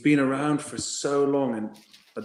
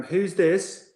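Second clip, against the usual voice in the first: higher-pitched voice answering a call; put-on questioning phone voice; coach on phone; 9 semitones higher than usual. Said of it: "George picked up the phone, and he's like".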